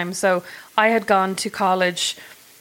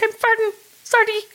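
much higher, squeaky apology; silly voice; 14 semitones higher than usual. Pretend. To an imagined policeman, a squeaky high apology. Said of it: "I'd be like".

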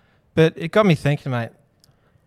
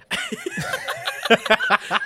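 laughing. Real, not acted. Laughing at cauldron joke.

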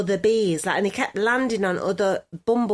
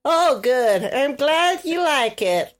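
raised southern twang; southern US accent; 4 semitones higher, 3 dB louder than usual. Pretend. Podcaster thanking her co-host, southern US accent. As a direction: accept praise in a put-on southern accent. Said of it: "that's your best accent".

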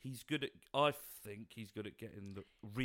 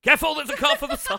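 mock alarm; 19 dB louder than usual. Mock warning to driving listener.

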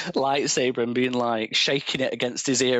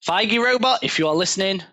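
calling out; 7 semitones higher, 4 dB louder than usual. Pretend. Calling out to the studio boss.

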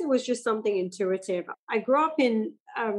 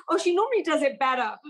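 higher-pitched imitation of embarrassed parents; apologetic parents; 6 semitones higher than usual. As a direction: mimic a parent excusing the child's act. Voices parents excusing the child's attempt.

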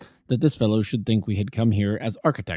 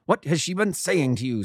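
higher, furious shouted demand; furious scream; 7 semitones higher than usual. A narrator's reading. Roylott repeating his demand, furious. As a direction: scream it at him in rage. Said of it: "screamed the old man furiously".

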